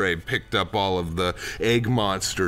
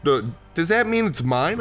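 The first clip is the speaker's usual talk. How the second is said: put-on high, stammering child's question; hopeful child; 8 semitones higher than usual. Child asks [name], high hopeful voice. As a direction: pitch it up, timid and eager.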